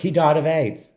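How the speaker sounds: loud, high dramatic line; dramatic exclaim. Retold or imitated. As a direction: blurt it out. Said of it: "at the end where it was like".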